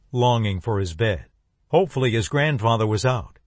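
plain reading voice

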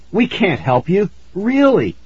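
high, urgent plea; pleading. Acted. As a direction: insist high and scared. Boy pleading.

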